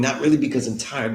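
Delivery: ordinary talk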